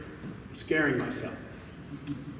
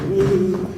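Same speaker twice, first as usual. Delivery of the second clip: mock panting woo; 6 semitones higher, 10 dB louder than usual. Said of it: "he's like".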